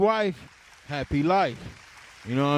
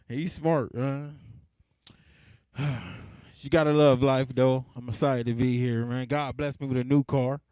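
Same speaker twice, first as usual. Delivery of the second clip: ordinary delivery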